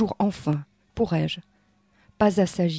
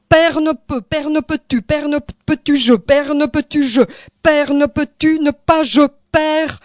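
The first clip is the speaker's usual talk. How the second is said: high, loud rhythmic incantation; urgent chanted appeal; insistent plea; 6 semitones higher, 4 dB louder than usual. Acted. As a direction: hammer out the repeated phrase, pitched high. Poem's repeated plea to father.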